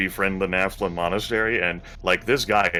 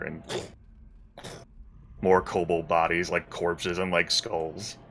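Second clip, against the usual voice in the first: ordinary delivery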